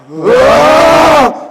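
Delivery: startled shout. Acted. Shout at birth pains.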